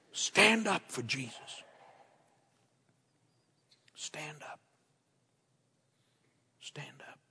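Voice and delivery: soft, fading to a whisper; hushed appeal. Real, not acted. Hushed appeal after shouted climax.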